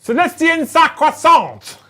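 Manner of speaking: put-on loud, high, slow French-accented battle cry; mock French accent. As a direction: proclaim it in a hammed-up French accent. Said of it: "French accent".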